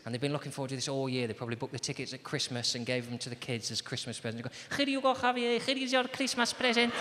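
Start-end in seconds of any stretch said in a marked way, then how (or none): 4.8-6.9 higher-pitched Spanish dad; Spanish accent. Acted. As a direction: put on a Spanish father handing out presents. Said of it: "Perfectly serviceable Spanish accent".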